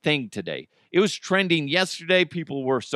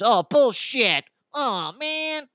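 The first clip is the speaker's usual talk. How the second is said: mock nerd banter; 9 semitones higher, slower than usual. Lightsaber buddies bickering.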